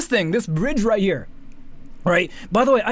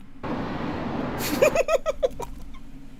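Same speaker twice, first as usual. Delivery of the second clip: a high, airless burst of laughter; breathy laugh; 4 dB quieter than usual. Real, not acted. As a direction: laugh under your breath in disbelief. Laughing at the comments.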